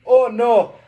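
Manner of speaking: high, loud cry of alarm; put-on crab impression, exclaiming loudly; Sebastian. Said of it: "Sebastian impression".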